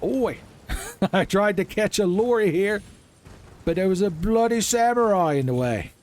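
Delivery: a laughing, terrible British accent impression; bad British accent. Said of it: "worst U K impression I've ever done".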